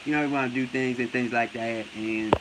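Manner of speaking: low, soft mock mumble; Errol Spence. Mocking the boxer's verbal tic, low quiet impression. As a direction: imitate his trailing filler talk, well below your pitch. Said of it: "Errol Spence says things like that at least five times".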